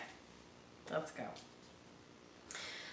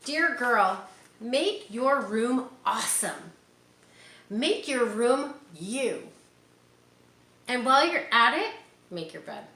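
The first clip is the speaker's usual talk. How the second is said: put-on reading to children; 3 semitones higher, 4 dB louder than usual. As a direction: read it like a picture book to little ones. Reading picture book aloud.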